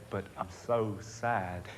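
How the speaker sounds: slow, stretched plea of sadness; drawn-out sad insistence. Acted. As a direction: drag the words out, insisting on the sadness. Insists on sadness, third time.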